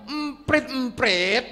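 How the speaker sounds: high, impatient repeat of Emprit; irritated son. Acted. Grown son losing patience with his father, high and impatient. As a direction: snap the answer at the nagging father.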